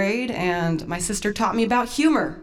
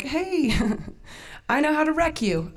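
sly threat; 7 semitones higher than usual. Retold or imitated. Mischievous scheme against sister.

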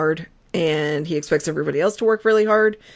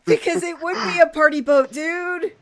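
excited; 10 semitones higher, 8 dB louder, slower than usual. Real, not acted. Excited agreement about party boat.

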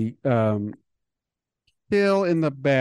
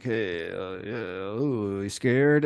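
taunting killer; 3 semitones lower, much slower than usual. Retold or imitated. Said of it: "getting in her face and being like".

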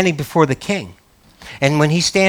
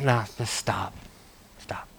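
quiet cutoff; 7 semitones lower, 17 dB quieter than usual. Retold or imitated. Cutting off flatterers.

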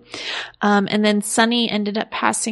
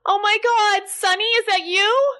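higher, shocked, fretful wife on the phone; Angie on the phone; 10 semitones higher than usual. Acted. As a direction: play the frantic wife who just saw him on TV.

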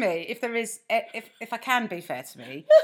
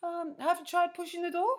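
high, mock Scandinavian receptionist; deadpan Scandinavian receptionist's question; generic Scandinavian accent; 9 semitones higher, 6 dB quieter than usual. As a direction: Scandi accent, patiently puzzled. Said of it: "that's my generic Scandinavian accent".

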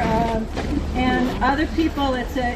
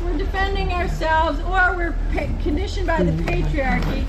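preaching; 5 semitones higher than usual. Acted. Carolyn preaching at her tent revival.

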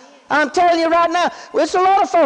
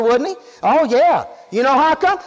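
ordinary talk, nothing marked

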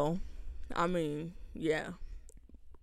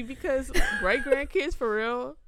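louder and higher, real laughter in the words; laughing through it; 6 semitones higher, 10 dB louder than usual. Real, not acted. Friend still laughing about the great grandkids request. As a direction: talk while still cracking up.

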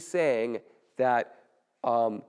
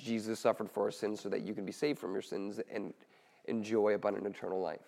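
soft sincere; 5 semitones lower, 9 dB quieter, faster than usual. Shown as a demonstration. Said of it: "how do I actually, like, speak".

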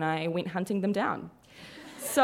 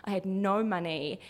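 normal talking voice

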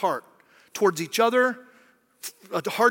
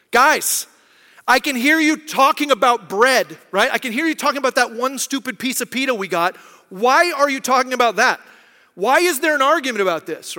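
louder, exasperated appeal to the group; mock exasperation; 3 semitones higher, 6 dB louder than usual. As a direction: scold them like an exasperated teacher. Voices Jesus scolding the bickering disciples.

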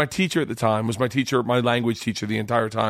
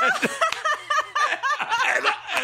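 high breathy burst of laughter; laughing hard, high up; big laugh. Real, not acted. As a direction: crack up at the memory. Recalling the guy turning.

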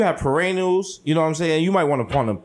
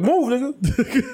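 high slow shove; 9 semitones higher, much slower than usual. Retold or imitated. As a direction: push past, laughing. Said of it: "I'm like".

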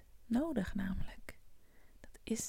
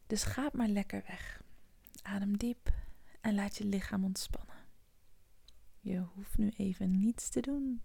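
right in your ear. Acted. Whispered meditation close up.

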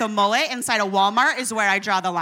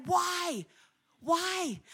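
higher, pleading why pushed up; incredulous why; 6 semitones higher, 4 dB quieter than usual. Retold or imitated. Imagined listener baffled at the disciples, incredulous. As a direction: ask it like it makes no sense.

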